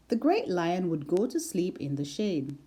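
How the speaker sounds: usual speaking voice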